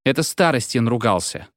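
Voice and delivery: ordinary delivery, nothing marked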